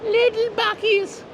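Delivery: high, drawn-out little squeal; cutesy tiny voice. Pretend. Vlogger showing off a miniature store, cutesy tiny voice. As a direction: squeak it out loud and slow, cutely.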